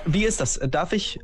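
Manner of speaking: ordinary delivery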